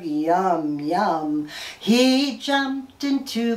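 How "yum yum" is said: much lower yum yum; low frog voice. Pretend. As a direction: rumble it like a frog. Frog eating bug.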